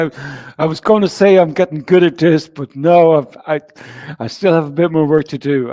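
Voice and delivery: usual speaking voice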